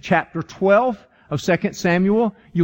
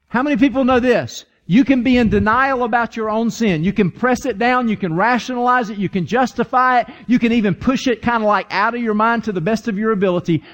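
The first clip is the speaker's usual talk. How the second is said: raised, urgent pulpit voice, partly quickened; preaching; 4 semitones higher than usual. Acted. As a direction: drive the list home to the congregation. Preaching builds up.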